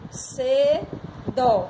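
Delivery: cedo slowly as "ce... dó"; stretched, separated "CE, DÓ" for cedo; syllable by syllable. Shown as a demonstration. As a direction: pause between the two syllables of cedo. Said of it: "tem de ser".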